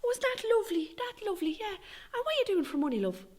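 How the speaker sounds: high, chirpy Irish busybody voice; high sing-song gossip voice; nosy neighbour. Retold or imitated. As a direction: play the nosy old neighbour prying sweetly. Retold Mrs Brady prying again.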